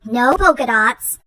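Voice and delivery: ordinary delivery, nothing marked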